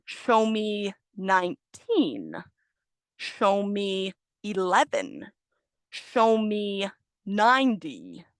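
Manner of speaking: very slow, every syllable drawn out; over-enunciated. Shown as a demonstration. Teacher telling students which number to point to, slowly over-enunciated. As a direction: stretch each word so the number endings are unmistakable. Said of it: "really, really over enunciate it".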